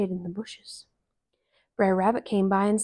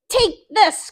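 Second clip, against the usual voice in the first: high loud attacking cry; cried; 12 semitones higher, 6 dB louder than usual. Acted. Rabbit kicks the stuffed rabbit, loud cry. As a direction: yell it as you kick. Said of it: "cried Brer Rabbit".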